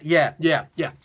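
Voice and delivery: ordinary talk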